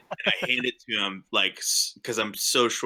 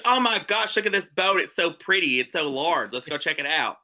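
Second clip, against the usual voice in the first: excited gushing; 6 semitones higher than usual. Acted. Barbara gushing over the boat.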